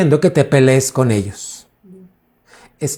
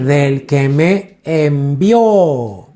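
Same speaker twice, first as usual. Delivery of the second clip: envió drawn out very slowly onto a long final ó; stress on final O; much slower than usual. Shown as a demonstration. Preacher repeating the answer to the church, envió with a long stressed ó. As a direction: stretch and lean on the last vowel of envió. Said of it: "con acento en la O".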